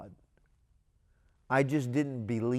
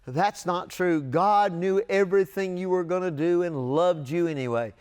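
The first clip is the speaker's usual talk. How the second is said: loud, higher, emphatic reassurance; louder and higher, insisting on every clause; emphatic insistence; 6 semitones higher, 6 dB louder than usual. Retold or imitated. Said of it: "And I would say".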